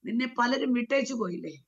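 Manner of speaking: ordinary talk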